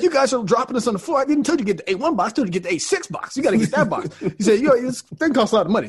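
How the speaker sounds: old clerk scolding staff over the wrong box, mock stern; scolding old man. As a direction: tell them off, half laughing. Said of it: "he's like".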